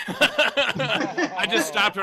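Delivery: slow laughing narration. Real, not acted. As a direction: laugh while telling. Laughing while telling.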